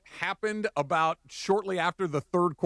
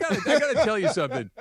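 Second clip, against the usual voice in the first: fast, laughing, louder; 7 semitones higher, 4 dB louder, faster than usual. Real, not acted. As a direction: blurt it out through laughter. Amused at the nickname joke.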